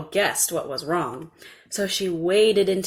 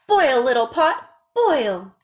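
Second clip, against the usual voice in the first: put-on higher, louder little girl's chant; commanding the pot; 5 semitones higher, 7 dB louder, slower than usual. A narrator's reading. Little girl casting the spell on the pot, firmly.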